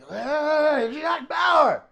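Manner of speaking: high, very loud mock fan yell; fan's shout. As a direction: holler it like a star-struck passerby. Imitating a fan yelling at Kiefer.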